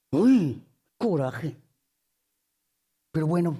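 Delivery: low, envious grumble to oneself; envious grumbling. Acted. Preacher voices someone jealous of another woman's luck, grumbling.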